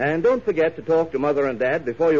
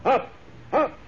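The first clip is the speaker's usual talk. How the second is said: heroic cry; 10 semitones higher, slower than usual. Acted. Superman takes off.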